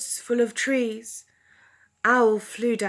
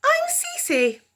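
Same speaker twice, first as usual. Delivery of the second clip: very high little cub voice; fox cub voice. Acted. Fox cub Cece introducing herself, very high. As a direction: squeak like a tiny fox cub. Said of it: "one fox cub said".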